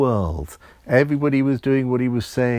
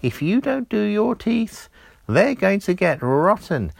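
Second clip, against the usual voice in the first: little elf warning; 7 semitones higher than usual. A narrator's reading. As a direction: warn him like a fussy little elf. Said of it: "The little elf said".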